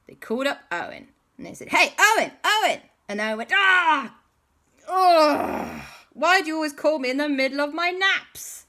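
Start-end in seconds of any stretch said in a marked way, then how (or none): 1.7-2.8 high, loud calls of a name; calling out. Acted. Calling Owen on the phone. 3.5-8.6 high, loud wailing complaint; woken-up whine. Acted. Owen woken from a nap.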